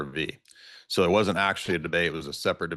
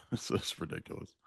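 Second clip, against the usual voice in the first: laughing; 12 dB quieter than usual. Real, not acted. Laughing exasperated repetition, unvoiced.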